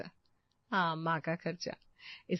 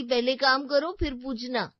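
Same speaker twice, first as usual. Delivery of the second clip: mock mother-in-law; 6 semitones higher, 6 dB louder than usual. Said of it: "पूछेंगे तो बोलेंगे".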